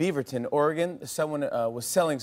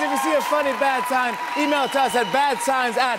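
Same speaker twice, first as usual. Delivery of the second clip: loud, upbeat announcer sign-off; show host outro; 11 semitones higher, 5 dB louder than usual. Acted. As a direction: wrap up like a TV host.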